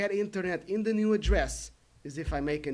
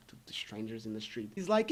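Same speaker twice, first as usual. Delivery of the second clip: usual speaking voice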